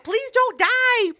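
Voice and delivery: high, slow desperate plea; pleading. Retold or imitated. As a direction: stretch it out, begging him. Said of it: "And she's like".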